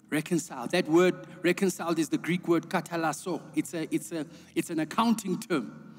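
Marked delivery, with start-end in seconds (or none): none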